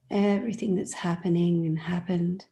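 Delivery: usual speaking voice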